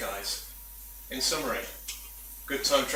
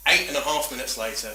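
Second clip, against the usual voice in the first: ordinary talk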